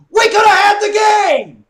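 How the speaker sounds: high, very loud outburst; frustrated yelling. Real, not acted. Upset fan over a blown game, yelling. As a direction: yell it out in exasperation.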